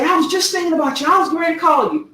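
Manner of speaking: quick, high-pitched quote of a sister; imitated sister's greeting. Sister greeting her sibling on the phone, quick high imitation.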